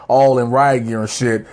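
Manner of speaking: normal talking voice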